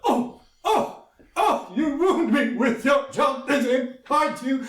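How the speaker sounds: high, slow mock groans; hammed-up wounded warrior. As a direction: overplay the pain for the kid. Sagoro groans under child.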